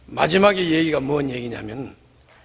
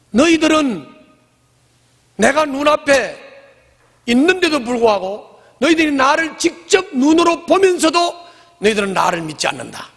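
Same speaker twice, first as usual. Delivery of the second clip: higher, rising scolding; stern rebuke; 8 semitones higher than usual. Acted. Jesus rebuking the crowd, raised and stern. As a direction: scold them for not believing what they see.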